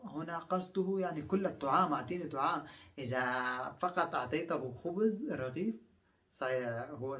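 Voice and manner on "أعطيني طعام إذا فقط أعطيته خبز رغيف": normal talking voice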